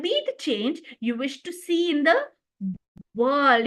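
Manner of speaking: long pause, then WORLD; stress on world. Shown as a demonstration. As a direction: hold back before world, then land firmly on it. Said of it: "the emphasis is laid on the word world".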